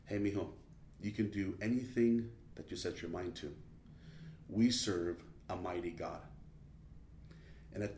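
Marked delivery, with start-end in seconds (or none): 0.1-6.2 very soft, low, reassuring murmur; low and hushed, close to the ear; retold motherly whisper. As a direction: imitate a mother's hushed bedtime encouragement. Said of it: "she whispers in my ear".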